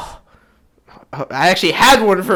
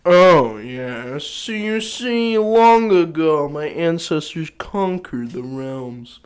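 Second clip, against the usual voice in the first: confident noble. Shown as a demonstration. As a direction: stretch the words grandly like a noble. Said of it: "a very confident noble".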